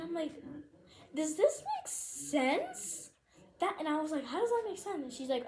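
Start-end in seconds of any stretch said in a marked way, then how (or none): 1.1-3.1 high, slow, drawn-out disbelief; incredulous retort. Retold or imitated. Acted incredulous retort in argument. 4.3-5.0 high-pitched acted disbelief; incredulous retort.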